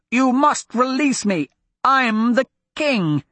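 high, slow royal demand; petulant prince. Acted. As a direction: insist on his crown.

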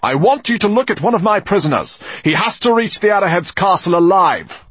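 loud, high angry orders; commanding. A narrator's reading. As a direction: give the order harshly. Firefox ordering loudly.

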